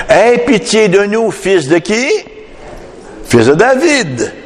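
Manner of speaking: high-pitched cry for mercy; acted plea. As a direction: call out imploringly, as the blind men would. Preacher voices blind men's plea to Jesus.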